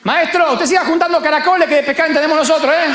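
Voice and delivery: high, fast, cheeky put-down; mocking retort. Juan tells the stranger on shore to stop meddling, cheeky high retort. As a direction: brush him off with a smirk.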